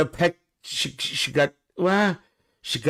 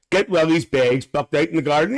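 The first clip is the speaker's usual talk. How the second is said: ordinary talk, nothing marked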